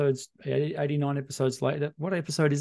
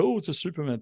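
higher-pitched mock media excitement; mocking hype; 6 semitones higher than usual. Host mocking how media grab hold of supermoons, mocking hype. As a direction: parrot the hype with mild scorn.

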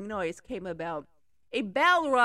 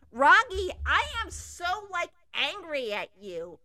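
screamed; 6 semitones higher, 4 dB louder than usual. Acted. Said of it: "the six screamed".

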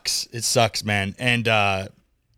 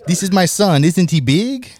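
high, loud Borat impression; 9 semitones higher, 5 dB louder than usual. Host jokingly quoting Borat to his co-hosts. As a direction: show off the son like Borat would. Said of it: "turned into Borat".

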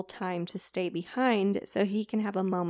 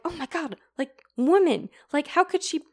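high, loud, exasperated mock rant; whiny rant; 6 semitones higher, 6 dB louder than usual. Rejected Ichabod ranting about Katrina, high loud whiny mock rant. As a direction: wail it like a rejected teen. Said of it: "he basically goes on this rant".

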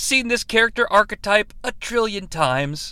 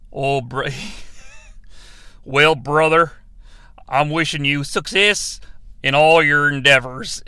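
put-on slipping cowboy accent; 5 semitones lower than usual. Host mimicking Stone's toast to his brother, slipping cowboy accent. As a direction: imitate an actor's shaky cowboy accent on a toast. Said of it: "doing Stone with sort of like a cowboy".

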